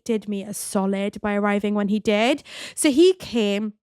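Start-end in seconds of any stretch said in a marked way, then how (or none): none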